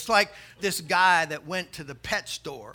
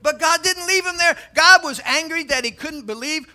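loud, raised sermon delivery; full-force preaching; 6 semitones higher, 8 dB louder than usual. Real, not acted. Preacher explaining God's anger at Moses, preaching hard. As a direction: drive each phrase home to the congregation.

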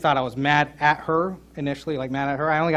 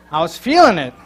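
louder, punched feeling; preacher stressing his own pressure, louder; emphatic feeling it; 2 semitones higher, 9 dB louder than usual. Shown as a demonstration. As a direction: lean into it for the crowd. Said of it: "Thanks, Evan".

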